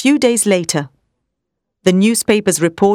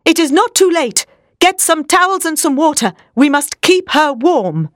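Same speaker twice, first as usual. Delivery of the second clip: high, raised urgent commands, slowing at the end; urgent orders; 8 semitones higher than usual. A narrator's reading. Professor ordering Seward to fetch towels, raised urgent voice. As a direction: bark out orders to save her. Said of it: "he shouted to Seward".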